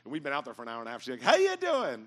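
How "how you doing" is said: very high, loud chirpy hello; imitating a relentlessly happy flight attendant; smiling greeting. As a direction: greet with an exaggerated big smile in the voice. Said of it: "the biggest smile in the world on her face".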